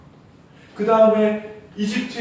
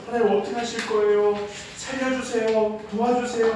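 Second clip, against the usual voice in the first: higher-pitched acted plea to God; mock crying out; 7 semitones higher than usual. Moses begging God for rescue, retold crying out. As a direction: plead for help, lightly dramatised. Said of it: "하고 부르짖었어요".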